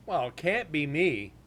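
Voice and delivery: normal talking voice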